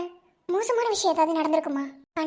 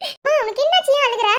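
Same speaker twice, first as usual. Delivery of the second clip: alarmed; 8 semitones higher, 7 dB louder than usual. Acted. Child alarmed at crying mother.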